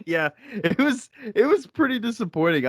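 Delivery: words riding on laughter; laughing. Real, not acted. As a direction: talk through a high laugh. Laughing while agreeing.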